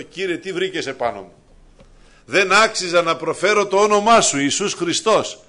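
fervent confession of unworthiness; impassioned preaching. Acted. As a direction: cry out humbly to the Lord. Preacher voicing prayer of unworthiness.

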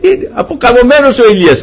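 laughing lightly through the line; amused chuckle. Real, not acted. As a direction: chuckle fondly as you say it. Chuckles at Elijah's collapse.

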